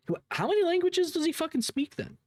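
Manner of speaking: high, baffled rhetorical question; incredulous question. Real, not acted. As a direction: pitch shoots way up in disbelief. Incredulous at multilingual streamer.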